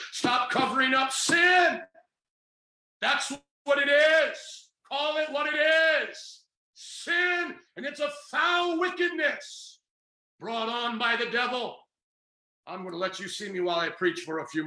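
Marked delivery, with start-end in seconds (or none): 0.1-11.8 preaching at full force. Real, not acted. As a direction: thunder it at the congregation like a Pentecostal preacher. Preacher denouncing sin.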